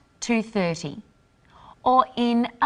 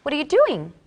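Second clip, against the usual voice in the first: higher-pitched challenge, falling on "doing"; accusing; 7 semitones higher, 4 dB louder, faster than usual. Shown as a demonstration. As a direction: catch someone doing something wrong. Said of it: "suggests that someone is doing something wrong".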